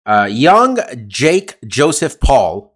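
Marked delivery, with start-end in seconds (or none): 0.3-0.8 put-on young said slowly and drawn out, leaning on it; stress on young. Shown as a demonstration. Host mocking Jake Paul's age to co-host, stressing young. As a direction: lean hard on young and stretch it out. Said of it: "I'd like to emphasize the word young".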